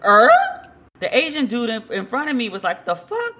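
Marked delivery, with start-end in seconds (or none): 2.8-3.3 high-pitched, softer stunned question; mock disbelief. Man in line reacting to the insult, in mock disbelief. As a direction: squeak it like a stunned onlooker.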